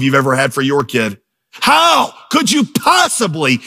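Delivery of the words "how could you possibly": high-pitched, drawn-out preacher's cry; emphatic exclamation. Real, not acted. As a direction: throw out the rhetorical question, stretching the opening word. Preacher's emphatic rhetorical question.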